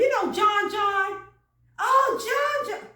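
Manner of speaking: high, loud burst of shared recognition; excited recognition. Acted. Jogging a friend's memory about John, excited recognition. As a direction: play up the delight of knowing him. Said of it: "That's how we do".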